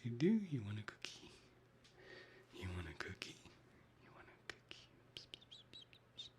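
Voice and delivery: sing-song whisper calling a pet; coaxing whisper. Real, not acted. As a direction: coax the cat over with whispered psst-psst.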